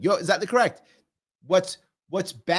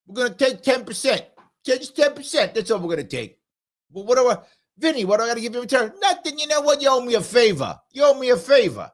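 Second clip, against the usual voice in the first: put-on high-pitched New York mobster accent; hammed-up mobster accent, pitched well up; mob accent; 8 semitones higher, 4 dB louder than usual. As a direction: play a mafia shakedown in a mob accent. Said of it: "I do a better mob accent".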